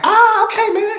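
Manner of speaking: high, loud mock yelp of surrender; imitated pained surrender. Big man caught in a finger hold, pained yelp imitated. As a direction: cry out high, giving in at once.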